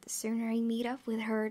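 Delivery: usual speaking voice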